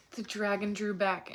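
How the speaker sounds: usual speaking voice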